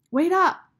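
high, loud call across distance; shout. A narrator's reading. Rob calls after Sistine as she walks away, loud. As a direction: call after her desperately. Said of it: "he shouted".

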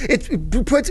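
ordinary talk, nothing marked

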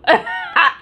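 a sudden high burst of laughter; high squealing laugh. Real, not acted. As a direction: let a high laugh break out. Laughs at her own complaint.

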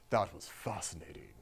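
low, soft, measured reply; Martin. Retold or imitated. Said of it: "I did the whole thing as Martin".

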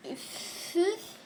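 breathy sigh, then a high huff; exasperated sigh. Real, not acted. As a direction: huff out in frustration. Failing the level again.

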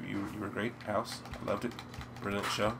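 normal talking voice

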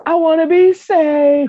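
high, slow mock pleading; mock plea. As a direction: beg to be saved in a put-on high voice. Mocking people who want saving.

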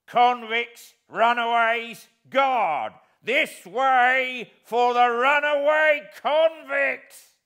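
loud, slow, drawn-out shout across the marsh; calling out. Acted. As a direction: yell for the guard at full voice. Guard calling out for help.

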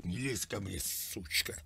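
ordinary talk

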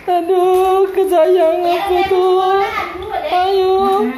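high, loud cooing call to a pet. Real, not acted. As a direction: coo at your darling, high and loud. Calling sweetly for a pet.

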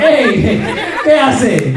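high, loud comic challenge; mock confrontation. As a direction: call him out in a hammed-up protest. Acts out confronting the rival suitor.

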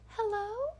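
put-on high, thin little-girl doll call; eerie doll asking hello; creepy doll voice. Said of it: "sounds like a creepy doll from a horror movie".

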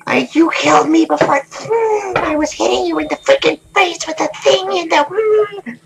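very high falsetto grumble at the enemy; whiny high voice, mock indignant; squeaky mock complaint. Player complaining to enemy in high squeak. Annoyed at enemy who killed him.

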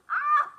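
high, loud yelp of terror; terrified scream. Retold or imitated. Fleeing from the dog, a loud high scream. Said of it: "No, I'm talking about".

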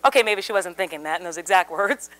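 ordinary talk, nothing marked

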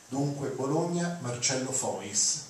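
usual speaking voice

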